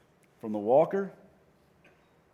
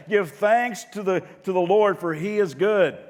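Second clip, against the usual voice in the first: say it like meaning it; 8 semitones higher, 9 dB louder than usual. Shown as a demonstration. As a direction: belt it out to lead the congregation. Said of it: "say it like you mean it".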